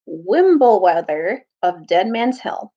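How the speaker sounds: ordinary delivery, nothing marked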